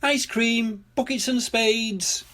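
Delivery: high, drawn-out vendor's call. A narrator's reading. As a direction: sing out the wares like a shopkeeper. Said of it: "called Miss Rabbit".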